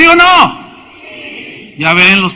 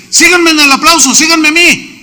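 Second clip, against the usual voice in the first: loud, high call to follow; rally the crowd to clap with you; 7 semitones higher, 4 dB louder than usual. Retold or imitated. Director calls the congregation to clap. (llamado fuerte y agudo)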